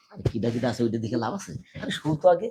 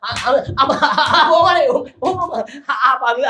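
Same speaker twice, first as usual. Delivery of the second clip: loud, much higher, stammering in terror; panicked cry; 12 semitones higher, 13 dB louder, slower than usual. Acted. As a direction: yelp out the words like he's been caught. Caught, panicked stammering cries. (আতঙ্কে চিৎকার করে তোতলানো)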